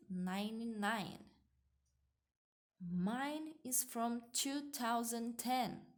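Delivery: slow, clear dialogue for learners to repeat; slow dialogue reading. Shown as a demonstration. Teacher reads the Peter and Tom dialogue slowly for students to repeat. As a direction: read each line slowly and carefully, like a model for students. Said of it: "vou falar mais devagar pra vocês poderem repetir".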